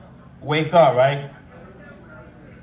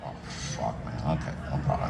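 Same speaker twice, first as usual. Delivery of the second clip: muttered aside; 11 dB quieter than usual. Retold or imitated. Defeated reply to bossy nurse.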